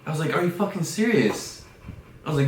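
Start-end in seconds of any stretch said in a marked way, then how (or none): 0.3-1.6 high, loud incredulous yell; retold indignant outburst; outraged disbelief. Retold outraged reply to parents.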